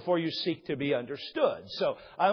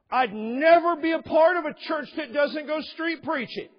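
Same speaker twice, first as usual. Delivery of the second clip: high, loud indignant declaration; indignant preacher; 12 semitones higher, 6 dB louder than usual. Retold or imitated. Pastor imitating the man's indignant ultimatum. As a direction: proclaim it with outrage.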